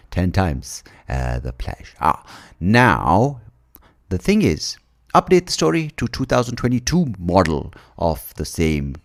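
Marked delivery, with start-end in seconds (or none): none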